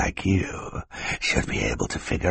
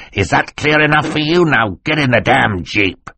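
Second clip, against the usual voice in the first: high, raised villain outburst; furious order; 7 semitones higher than usual. Acted. Stefano ordering the orphans out, angry and high. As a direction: burst from menace into angry command.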